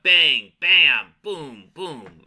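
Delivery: comic-book sound effects. Acted. Reader voicing effects popping up in the comic, punchy. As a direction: punch each effect out, falling in pitch. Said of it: "sound effects are popping up".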